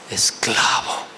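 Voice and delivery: hushed, breathy whisper; whispered verdict. Acted. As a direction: whisper it like a grim sentence. (susurrado como sentencia)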